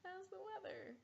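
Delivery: high, slow forced small talk; awkward mom voice. Retold or imitated. Mom to daughter during sex scene, high awkward small talk. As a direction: play the mom filling silence nervously. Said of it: "your mom decides ... she's just like".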